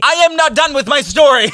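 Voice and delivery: high, loud mock indignation; indignant outburst.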